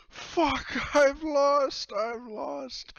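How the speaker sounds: high, loud wail; hammed-up anguished shout; captain bewailing a loss. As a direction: cry out in exaggerated defeat. Captain loses the coin toss.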